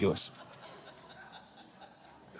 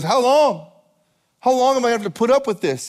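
high, loud acted complaint to God; exasperated plea; 8 semitones higher, 4 dB louder than usual. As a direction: cry it out, then rush the rest. Voicing David fed up with waiting.

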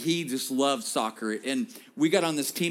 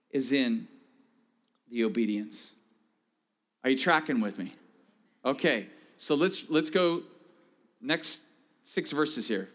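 ordinary talk

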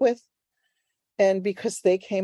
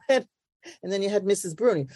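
ordinary delivery

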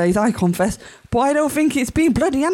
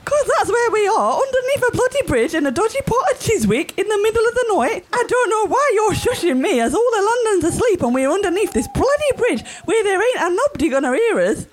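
high, fast, exasperated complaint; loud indignant rant; 10 semitones higher than usual. Acted. Wife ranting at husband who keeps shushing her, loud. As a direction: refuse to be shushed under the bridge.

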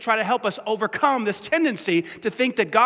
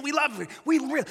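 usual speaking voice

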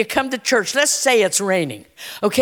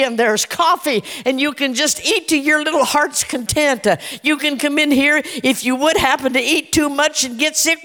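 usual speaking voice

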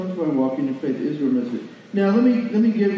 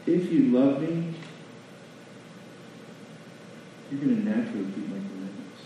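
gentle explanation; 4 semitones lower, 7 dB quieter than usual. Shown as a demonstration. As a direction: ease off and let the meaning land. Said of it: "This is cut in half, this verse".

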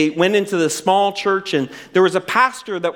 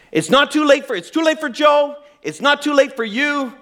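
blunt preacher; 9 semitones higher than usual. Retold or imitated. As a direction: drive it home, no mercy. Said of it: "I don't teach any of my students to speak like this".

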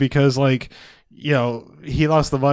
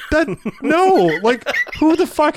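high protest; 11 semitones higher than usual. Real, not acted. Laughing protest about postcards.

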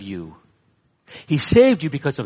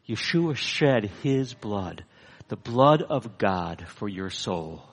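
slow, soft, lower, weighty; solemn quiet appeal; 5 semitones lower, 6 dB quieter, slower than usual. Real, not acted. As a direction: let every word fall slowly and quietly.

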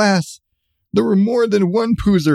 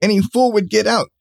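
usual speaking voice

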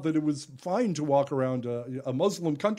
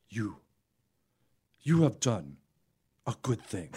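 solemn blessing; 7 semitones lower than usual. Retold or imitated. Stranger holding the juggler's shoulders, solemnly thanking him in a low voice. Man looks in his eyes.

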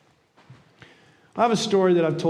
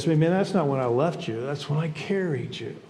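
lower, calm, easy reply; Jesus answering; 5 semitones lower, 3 dB quieter than usual. Acted. Jesus reassuring the man on the beach, calm and low. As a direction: answer the complaint softly, dropping at the end. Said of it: "and Jesus says".

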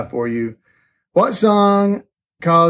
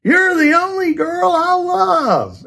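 very high, stretched-out mock holler; hammed-up slow cry of love, held high; loud declaration; 14 semitones higher, slower than usual. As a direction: holler it slowly over the music. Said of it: "he loudly goes".

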